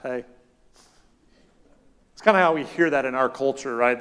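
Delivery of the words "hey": flat casual hey. Shown as a demonstration. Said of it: "kind of like".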